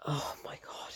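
voiceless, hushed shock; horrified whisper. Retold or imitated. Reliving finding out it's not Owen, horrified whisper. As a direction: whisper it as the dread sinks in. Voicing horrified realisation at the reveal.

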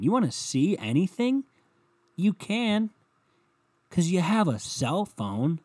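slow down on cell phone, incredulous; ranting at bored people, exasperated. Acted.

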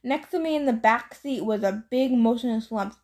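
ordinary delivery

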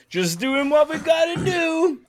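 very high, mock shouted quote; exaggerated quote. Host proclaiming the sentiment of the answer, high mock shout. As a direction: belt it out in a silly high voice. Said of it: "the sentiment is".

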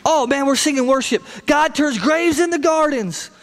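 sham-excited churchgoer; customer service church voice. Acted. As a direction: gush piously, putting it on. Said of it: "our customer service church voice".

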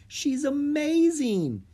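high, drawn-out wonder; amazed, high-pitched child; awestruck. A narrator's reading. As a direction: marvel at it in a high voice. Child first sees giant panda.

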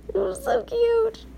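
high, slow cooing delight; gushing. Real, not acted. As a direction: coo it like you melt. Gushing over her son.

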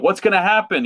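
mock tense viewer; high anxious question. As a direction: ask it anxiously. Viewer anxiety voiced.

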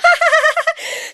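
a sudden high laugh; burst of laughter. Real, not acted. Recalling the chaotic fight, a real high laugh. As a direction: laugh out at the absurdity.

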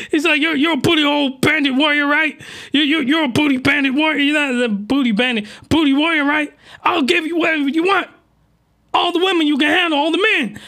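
high begging voice throughout; hammed-up high plea; mock pleading girl. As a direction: plead frantically in falsetto. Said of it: "She's just like".

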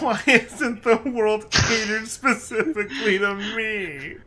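high, slow mock whine; hammed-up entitled whining; mock entitled complainer. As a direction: draw it out high, like a self-pitying customer. Mocking an entitled customer's complaint.

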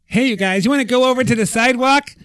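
very high, loud, hammed-up American; mock American accent. Shown as a demonstration. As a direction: shout it like a caricature American tourist. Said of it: "Do an American accent".

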